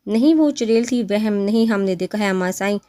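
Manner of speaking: plain reading voice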